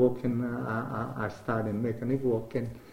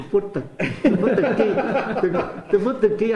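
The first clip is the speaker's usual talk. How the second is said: laughing through the words, higher and louder; 8 semitones higher, 9 dB louder than usual. Real, not acted. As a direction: crack up at his own story. Laughs recalling car key struggle.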